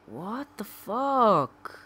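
high, loud, slow what the fuck; loud disbelief. Real, not acted. Gamer still searching, loud drawn-out disbelief. Frustration peaks while searching.

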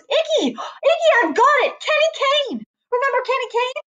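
very high, squealing excitement; excited realization. A narrator's reading. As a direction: cry out as the answer suddenly hits. Loretta jumps up with sudden recognition.

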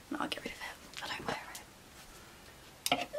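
slow, soft whisper; whispered to herself. Real, not acted. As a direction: murmur the decision to yourself. Deciding to discard, muttering.

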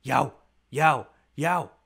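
loud, yelping Yow barks; loud mock dog yelps; dog yelling. A narrator's reading. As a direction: bark it out like a happy dog. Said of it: "wiggling his stub tail and yelling".

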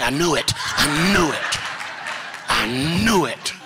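loud, drawn-out mock vindication; loud comic triumph, stretching the words; triumphant exclamation. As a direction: cry it out slowly, as if finally proved right. Punchline retort about being adopted.

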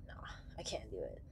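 breathy whisper, almost no voice. Retold or imitated. Trucker recalling quitting city jobs, whispered. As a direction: whisper it to yourself in defeat. Retelling own inner reaction.

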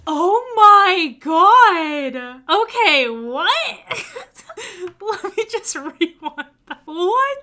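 loud stunned disbelief; shocked laughter. Real, not acted. Shocked reaction to video clip.

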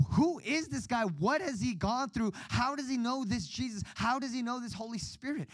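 high, awed questions tumbling out; amazed wondering. Retold or imitated. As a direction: marvel at who this man is. Amazed at the preacher.

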